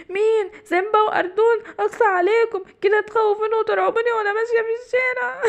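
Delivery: high tearful wail; giraffe crying. Acted. The frightened giraffe tearfully scolds Simba and Ardon. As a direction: sob out the complaint, hurt and scared.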